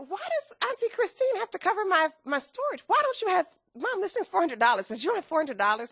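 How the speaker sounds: high, pushing protest; frustrated complaint. Retold or imitated. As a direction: argue with mom in exasperation. Frustrated with mom on phone.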